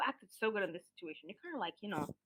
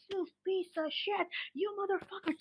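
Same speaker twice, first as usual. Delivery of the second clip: mock cursing; 7 semitones higher, 3 dB quieter than usual. Customer cursing out the pharmacy manager, mock angry. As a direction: imitate an abusive customer without raising volume.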